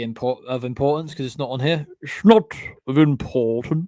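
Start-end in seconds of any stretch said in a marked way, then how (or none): 2.0-3.9 silly put-on voice. Echoing own remark about James, silly put-on voice. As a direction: stretch the phrase out in a mock character voice.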